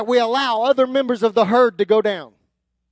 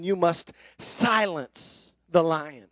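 normal talking voice

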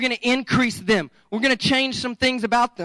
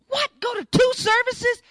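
much higher, shouted disbelief; mock outrage; 12 semitones higher than usual. Pastor voicing a congregant shocked at serving twice, shouted. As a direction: squawk it like a shocked churchgoer.

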